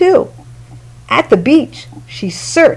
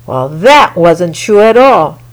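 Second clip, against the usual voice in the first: ordinary delivery, nothing marked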